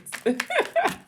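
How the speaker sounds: high-pitched burst of laughter. Real, not acted. Reader remembering trying her cousins' accent, laughing.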